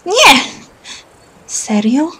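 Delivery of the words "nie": sharp shout. Acted. Girl reacting sharply to grab.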